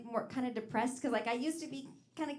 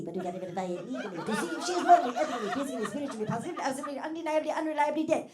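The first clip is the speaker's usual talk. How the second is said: loud, chanted coroner listing, rising higher; Munchkin coroner; 5 semitones higher, 5 dB louder, faster than usual. Pretend. Comedian doing the Munchkin coroner's speech. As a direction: rattle off the list like a munchkin official. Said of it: "sound like the Wizard of Oz".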